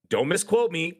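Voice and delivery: usual speaking voice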